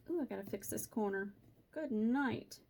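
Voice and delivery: usual speaking voice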